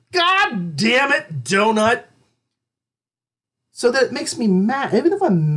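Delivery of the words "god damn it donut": slow, higher mock curse; lightly hammed-up mock swearing. Shown as a demonstration. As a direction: curse a donut playfully. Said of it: "the phrase".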